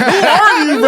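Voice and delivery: mock baffled shout. Friend voicing the reaction to the stoned comic, loud laughing. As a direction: blurt it out half laughing. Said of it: "it's like".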